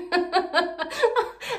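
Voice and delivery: high giggling laugh; self-amused laugh. Real, not acted. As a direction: laugh at your own silliness. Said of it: "ما تضحكوش عليا على هذا الهبال ديالي". (ضحكة على الهبال)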